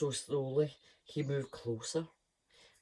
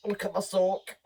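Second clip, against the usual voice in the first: louder, higher look at my sock; playful exclaim; 6 semitones higher, 7 dB louder than usual. Real, not acted. Reader playfully shows her sock.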